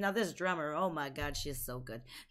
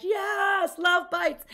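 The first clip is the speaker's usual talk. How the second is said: excited intro; 7 semitones higher, 4 dB louder, much slower than usual. Real, not acted. Announcing the band excitedly.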